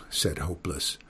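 plain reading voice

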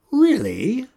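a high, loud, astonished really; old witch surprised by the news; surprised question. A narrator's reading. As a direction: sound caught off guard. Surprised at Hagatha's claim.

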